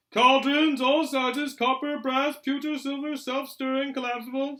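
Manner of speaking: high, loud shop-crier list; advertising sign. A narrator's reading. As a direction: call out the wares like a street vendor.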